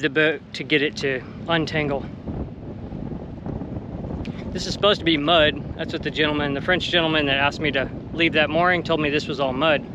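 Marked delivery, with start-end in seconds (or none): none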